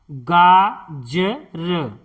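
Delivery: गाजर drawn out slowly as गा-ज-र; slow syllable-by-syllable. Shown as a demonstration. Teacher sounding out गाजर for pupils, slowly. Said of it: "इस तरह से".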